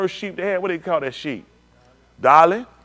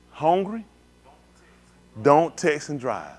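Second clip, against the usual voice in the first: ordinary talk, nothing marked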